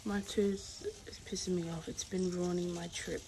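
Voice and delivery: normal talking voice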